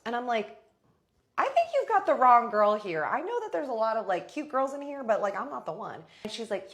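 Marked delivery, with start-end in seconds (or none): 1.4-6.0 loud, high retold protest; acted-out defensive comeback; indignant pushback. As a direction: set her straight with raised voice and pitch. Pushes back at angry dancer.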